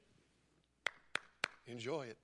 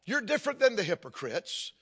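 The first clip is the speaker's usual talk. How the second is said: ordinary talk, nothing marked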